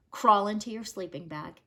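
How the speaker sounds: ordinary delivery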